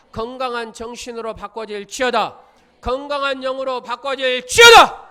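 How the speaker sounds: building to a shouted proclamation; preaching at full force. Real, not acted. Pastor commands healing over the congregation, shouting.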